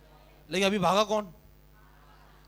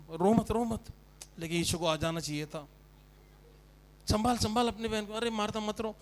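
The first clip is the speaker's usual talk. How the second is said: mock consoler; 4 semitones lower, 7 dB quieter than usual. Mourners voiced consoling Martha.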